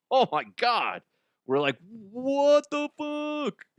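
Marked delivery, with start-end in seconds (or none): 2.1-3.6 high, drawn-out mock disbelief; retold disbelief. Reacting with coworkers to a near stabbing, high incredulous drawl. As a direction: stretch it out, high-pitched and incredulous.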